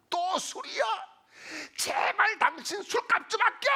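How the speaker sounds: high, loud scolding shout; imitated wife's yelling. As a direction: act out a fed-up wife yelling at her husband. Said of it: "막 이렇게 소리를 지르고 삿대질을 했어요".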